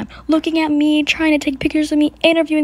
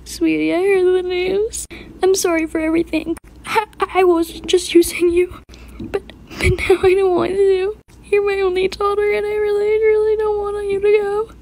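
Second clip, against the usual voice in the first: much higher, crying mother's plea; mother begging her dying daughter's forgiveness, in tears; tearful apology; 7 semitones higher than usual. Acted. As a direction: sob out how sorry you are.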